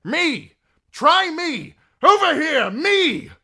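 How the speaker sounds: high, loud baiting calls; shouting to draw attention. Acted. Barristan luring the dragon away from Dany: high, loud shouts. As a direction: lure the dragon toward you. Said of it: "Barristan Selmy shouting".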